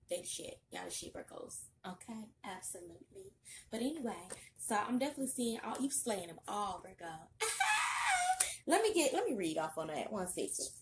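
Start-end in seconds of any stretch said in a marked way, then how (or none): 0.1-3.3 breathy whispered hype. Real, not acted. Aside hyping Virgos. 7.2-8.6 shrieking laugh. Real, not acted. Reacting to slaying remark.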